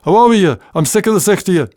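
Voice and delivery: loud, high Bathgate shout, fast at the end; shouted Scots scolding. Retold or imitated. Mother shouting son outside to play, in Bathgate Scots. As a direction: chase him out the door in broad Scots. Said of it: "the same ring to it as".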